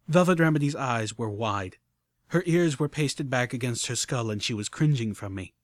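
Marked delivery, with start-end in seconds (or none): none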